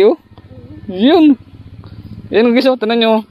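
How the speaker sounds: showing off the big fish; excited. Real, not acted.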